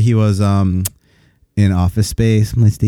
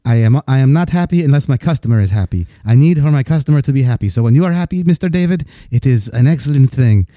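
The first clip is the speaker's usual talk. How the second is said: put-on higher-pitched, effusive Indian-accented agent; 4 semitones higher than usual. Fios agent thanks his customer on the line, eager Indian accent. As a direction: gush politely in an Indian accent. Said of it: "he was, like, going off on".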